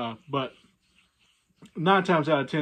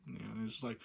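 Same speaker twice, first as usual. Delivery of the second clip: normal talking voice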